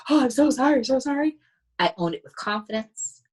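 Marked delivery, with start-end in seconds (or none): 0.1-1.4 high, louder mock groveling; hammed-up meek sorry, pitched up; meek apology. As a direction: play her childhood self over-apologizing. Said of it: "be very meek".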